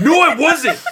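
very high, loud petulant protest; mock indignant denial. Husband imitating his sleepwalking self denying it, mock indignant. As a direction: squeal it like a sleepy sulker caught out. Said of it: "He was so mad".

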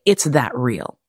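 ordinary talk, nothing marked

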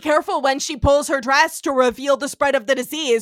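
loud, emphatic mock censor; mock network warning. NBC warning the show about the dress scene, loud mock caution. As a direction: bark the warning like a fussy network exec. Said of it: "N B C was like".

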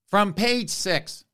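ordinary delivery

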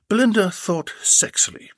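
ordinary delivery, nothing marked

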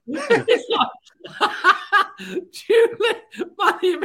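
high broken words between fits of laughter; gasping names through uncontrollable giggles; laughing too hard to talk. Real, not acted. Host trying to call out names through real laughter. Host cracking up, can't speak.

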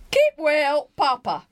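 loud Glasgow sign-off; hearty, emphatic Glasgow accent; granddad's Glasgow accent. Acted. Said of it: "do it in his accent".

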